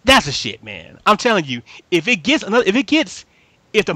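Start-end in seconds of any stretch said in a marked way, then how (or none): none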